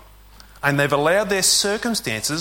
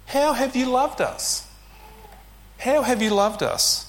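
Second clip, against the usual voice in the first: higher, doubting, scornful question; cynical retort; 9 semitones higher than usual. Retold or imitated. Israel answering God with mock cynical doubt. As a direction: ask it like you don't believe a word. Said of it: "a hint of cynicism".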